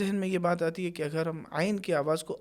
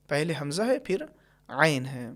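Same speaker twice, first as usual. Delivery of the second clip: normal talking voice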